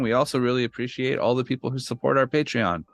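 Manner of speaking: upbeat podcast sponsor read. Acted. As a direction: sell the Patreon like an announcer.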